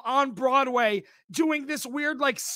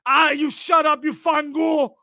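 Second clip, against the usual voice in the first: loud, higher hammed-up Italian-American snap; mock Italian mobster; 4 semitones higher, 6 dB louder than usual. Streamer playing the dad as an Italian mobster, loud. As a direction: wave it off like a cartoon Italian mobster. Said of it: "acted mob dad retort".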